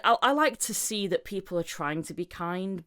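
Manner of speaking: ordinary talk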